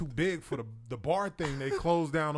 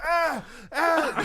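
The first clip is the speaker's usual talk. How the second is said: high, loud mock yelps; hammed-up squeals of pain; pained scream; 13 semitones higher, 7 dB louder than usual. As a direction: cry out as if your chest is crushed. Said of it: "So he's pressing".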